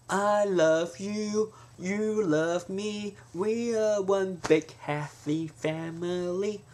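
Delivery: high, slow, sugary sweet; sweet and gentle. Shown as a demonstration. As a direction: coo it like a greeting card, slowly. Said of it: "But if you go".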